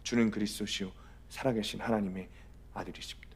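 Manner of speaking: low, very soft profession of faith; hushed declaration, fading to a near-whisper; retold confession. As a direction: confess it quietly and solemnly. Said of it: "손을 들고 일어나는 거예요".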